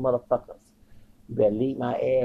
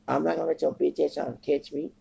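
usual speaking voice

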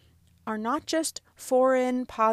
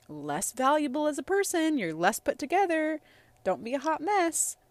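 higher-pitched, imitated voice of social disapproval; mock judgmental; 6 semitones higher than usual. Voicing social cues against crying in public, mock judgmental. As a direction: pass judgment in a raised, put-on tone.

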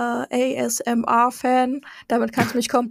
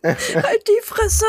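laughing mock outrage; 11 semitones higher, much slower than usual. Real, not acted. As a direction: laugh it out slowly. Laughing outburst at teasing.

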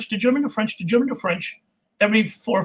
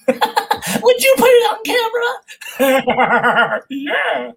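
laughing; 13 semitones higher, 4 dB louder, slower than usual. Real, not acted. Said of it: "joking about his face".

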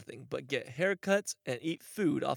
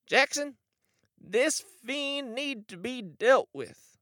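seething shrieking spirit; 10 semitones higher, 5 dB louder, slower than usual. Acted. Wonder voiced again.

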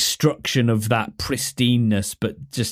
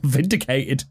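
laughing; 10 semitones higher than usual. Real, not acted. Mock triumph, laughing.

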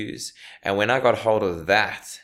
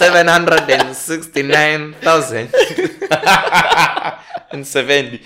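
laughing; 7 semitones higher, 8 dB louder than usual. Real, not acted. Laughing while reading numbers.

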